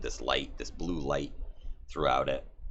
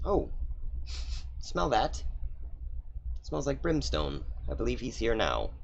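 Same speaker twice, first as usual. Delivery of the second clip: higher, sly sniffing villain; 7 semitones higher than usual. Acted. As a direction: savour the smell of brimstone, gleeful. Character sniffing brimstone.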